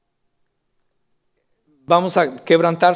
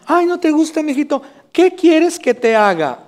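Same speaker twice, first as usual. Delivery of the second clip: doting mother; 10 semitones higher, 4 dB louder than usual. Retold or imitated. Mother coddling her picky son at the table, high doting coo. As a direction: coo at the child like an overindulgent mom. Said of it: "la mamá dice".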